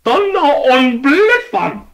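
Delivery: high, slow, loud curse; German oath yell. Acted. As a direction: swear it out in a German flavor. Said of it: "yelled the delicatessen man".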